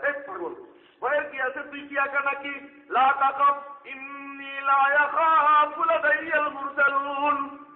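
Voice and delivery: loud, high, slowed preacher's cry; preaching at full force. Acted. Preacher calling out to the gathering in a loud, high cry.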